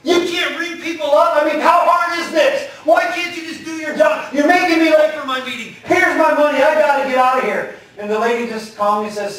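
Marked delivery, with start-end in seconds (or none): 0.0-7.8 higher, louder furious yelling at the clerk; impatient rant. Retold or imitated. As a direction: berate her like a man late for a meeting. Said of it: "he's just totally impatient".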